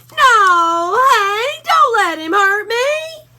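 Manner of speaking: a timid pup whimpering to his boss; scared Drover. A narrator's reading.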